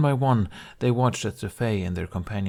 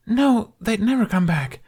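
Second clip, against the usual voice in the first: frightened denial; 13 semitones higher, 6 dB louder than usual. Acted. Explosions, fleeing through woods.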